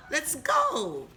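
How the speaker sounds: excited cry. Acted. Repeats Selma's cry, higher.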